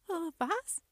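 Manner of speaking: high-pitched, startled hesitation; startled stammer. A narrator's reading. Gnome girl waking on a monster's belly, startled stammer. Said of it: "stammelte Hymi erschrocken".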